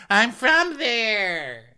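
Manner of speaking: high, slow silly bird voice; mock seagull voice. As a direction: answer like a seagull. Imitating seagull reply.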